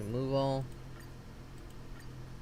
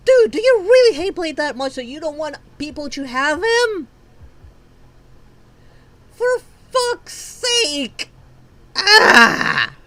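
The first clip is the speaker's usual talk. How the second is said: real rant; 6 semitones higher, 9 dB louder than usual. Real, not acted. Gamer cursing the developers, in loud real anger. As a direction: vent at the developer full force. Raging at the game maker.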